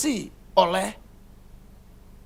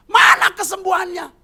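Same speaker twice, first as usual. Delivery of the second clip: high, loud taunting demand; mocking shout; 10 semitones higher, 7 dB louder than usual. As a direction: demand the miracle with scorn.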